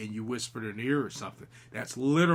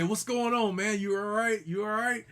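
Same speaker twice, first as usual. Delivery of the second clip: higher-pitched concerned call across the room; retold calling out; 9 semitones higher, 4 dB louder than usual. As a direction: call out to check on a friend. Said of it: "I say".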